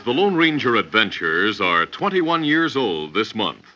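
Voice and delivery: normal talking voice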